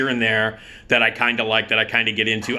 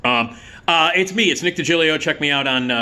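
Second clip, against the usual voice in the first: usual speaking voice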